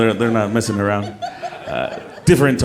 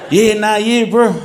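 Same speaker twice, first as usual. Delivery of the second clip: put-on higher laughing Kiwi accent; 8 semitones higher, slower than usual. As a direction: play the easygoing New Zealander. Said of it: "in a very Kiwi accent".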